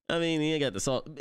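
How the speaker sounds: ordinary talk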